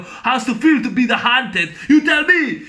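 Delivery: hammed-up movie showdown line; mock confrontation. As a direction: throw the question back, building to a shout. Acted confrontation quote from film.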